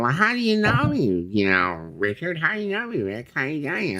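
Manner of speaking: very high, funky little-guy voice; high funky voice asking how he's known; David Sterling impression. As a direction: squeak it up like a quirky little guy. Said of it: "this is the way he talks. Exactly like this".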